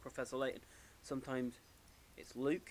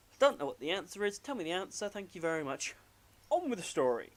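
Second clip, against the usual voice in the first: quick, higher-pitched brush-off; mock impatience; 5 semitones higher, 4 dB louder than usual. Player looking up answers online, impatient. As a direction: rattle it out, eager to move on.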